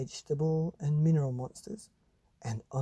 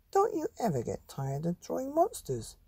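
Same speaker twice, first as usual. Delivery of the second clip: higher mother's voice; a mother asking, higher and curious; mum's voice; 10 semitones higher than usual. Acted. Mother to son over his shoulder, higher mum voice. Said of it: "she said".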